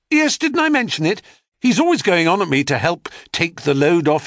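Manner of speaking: a high, puffed-up lie tossed off casually; boastful boy. A narrator's reading. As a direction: show off, pretending it's nothing.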